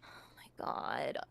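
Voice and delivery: slow despairing whisper. Real, not acted. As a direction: breathe it out in disbelief. Overwhelmed in argument.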